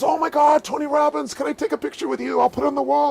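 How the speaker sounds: high, loud starstruck gush from the shop clerk. Retold or imitated. Shop clerk spotting Tony Robbins, high excited gush. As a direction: gush like a fan spotting a celebrity. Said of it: "the guy goes".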